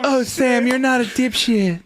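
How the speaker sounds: put-on girlfriend voice. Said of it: "Why does she talk that way?".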